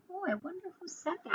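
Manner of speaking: ordinary delivery, nothing marked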